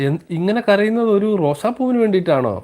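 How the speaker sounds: high, astonished disbelief; amazed. Acted. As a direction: gasp it out in disbelief. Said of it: "വളരെ അത്ഭുതത്തോടെ". (അത്ഭുതത്തോടെ ഉയർന്ന ശബ്ദത്തിൽ)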